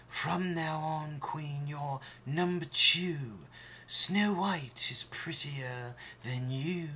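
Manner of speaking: slow, changed mirror voice delivering bad news; a deliberate, solemn mirror; magic mirror. Mirror telling the queen she is second, slow and grave. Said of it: "she heard the magic mirror say".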